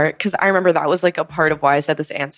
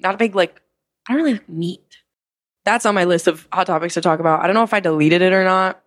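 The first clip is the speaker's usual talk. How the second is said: ordinary talk, nothing marked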